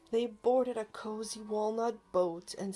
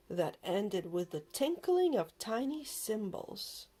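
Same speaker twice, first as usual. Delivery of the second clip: ordinary delivery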